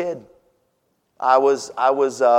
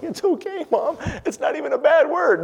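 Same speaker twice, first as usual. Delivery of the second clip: much higher, squeaky comforting voice; mock childish reply. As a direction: squeak it like a consoling boy. Mock quote of his own reply.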